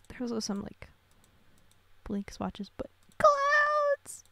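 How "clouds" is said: high, louder squeal of joy; delighted squeal. Real, not acted. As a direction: squeal the word out. Delighted at cloud dress.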